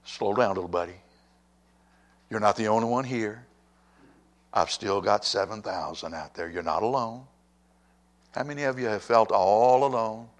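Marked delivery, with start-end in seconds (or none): 0.1-7.3 soft, reassuring words to a sulking friend; calm him down kindly; gentle God. Retold or imitated. God gently reassuring a sulking Elijah, soft and kind. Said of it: "In other words, God said".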